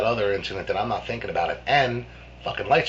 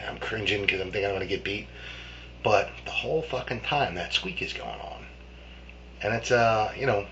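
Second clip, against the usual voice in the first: ordinary talk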